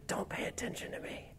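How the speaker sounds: hushed, voiceless whisper of temptation; sin's whisper. Acted. Preacher voicing sin telling you to ignore it, whispered. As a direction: breathe it like a sly little voice in your ear. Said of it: "that little voice that you hear whispering".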